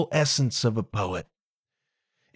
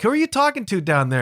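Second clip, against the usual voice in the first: higher and fast, calling down in surprise; puzzled call down; 7 semitones higher, much faster than usual. Retold or imitated. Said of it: "she's like".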